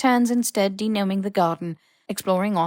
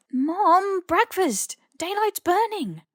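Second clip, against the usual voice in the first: high voice, mouth full of muffin; mouthful growl; 12 semitones higher, much slower than usual. A narrator's reading. Ron calling to his mum, high and muffled. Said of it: "with an angry growl around the second muffin".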